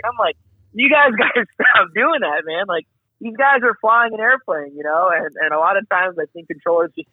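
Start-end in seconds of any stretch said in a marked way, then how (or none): 0.7-2.7 high-pitched, half-laughing exasperation; mildly mad controller. Retold or imitated. Controller fed up with pilots who missed his call, mildly mad. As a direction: scold them with mock annoyance. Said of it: "the controller gets mad".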